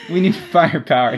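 mock urgent battle call. Joking about the team needing firepower, a mock urgent call. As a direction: bark it like a commander in a pinch.

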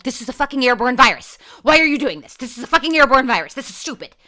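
loud, high exasperated rant; irritated rant. Retold or imitated. To her brother over hand sanitizer, an exasperated loud rant. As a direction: vent at the hand sanitizer, fed up. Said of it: "And I was like".

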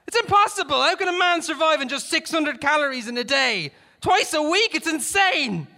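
high, loud, exasperated rant; yelling. A narrator's reading. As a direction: yell in disbelief. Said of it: "Halliday yelled back".

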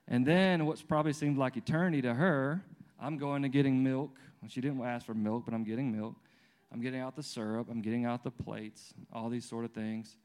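normal talking voice

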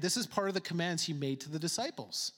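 normal talking voice

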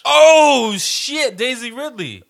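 a loud, high burst of realisation; sudden surprise. Real, not acted. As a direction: blurt it out as it suddenly clicks. S1 suddenly recognises Daisy Ridley.

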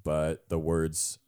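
slow, drifting into a hint of French accent; a light French accent. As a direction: slip a French lilt into the words. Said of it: "I slipped".

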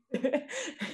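real giggle; high giggle. Real, not acted. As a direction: giggle before finishing the thought. Laughs mid-sentence.

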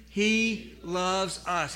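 slow and deliberate, every word weighed; slow call for the congregation to repeat; slow unison repeat. Shown as a demonstration. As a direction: lead the room through it slowly. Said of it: "Would you say that with me?".

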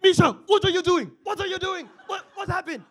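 very high, loud frantic mock yell; hammed-up screechy parental outburst; retold dad yelling. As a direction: imitate a panicked father shouting. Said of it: "yelling at my sister".

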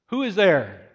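loud, high shouted question; calling out. Acted. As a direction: shout up to an unseen stranger. Hanging man calls to the voice.

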